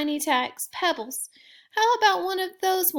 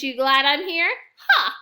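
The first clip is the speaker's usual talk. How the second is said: gloating laugh; 10 dB louder than usual. Acted. Orange Crayon gloating at rival.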